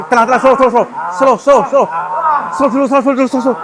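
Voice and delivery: rapid, loud, high repeated pleas; urgent calming. Real, not acted. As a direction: frantically try to calm it down. Urgently calming an agitated spirit.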